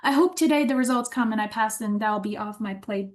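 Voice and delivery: ordinary talk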